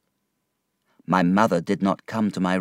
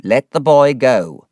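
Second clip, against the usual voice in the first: louder, firm command; stern order; 4 semitones higher, 8 dB louder than usual. A narrator's reading. Miss Murdstone telling the mother to release the boy, a loud stern order. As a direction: order her to let him go at once. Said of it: "ordered Miss Murdstone".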